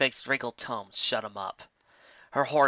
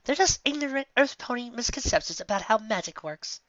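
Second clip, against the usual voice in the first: high, sneering, superior voice; snobbish contempt; 11 semitones higher than usual. Acted. Fast Volume sneering at Earth Ponies, high and haughty. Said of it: "Fast Volume's tone of voice".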